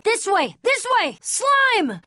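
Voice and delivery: high urgent calls; calling out. Acted. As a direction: shout directions to the group. Calling out during chase.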